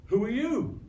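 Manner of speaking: high, loud "who are you"; startled question. Acted. Megan startled by a stranger, asking loudly. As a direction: ask it startled. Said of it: "And she says".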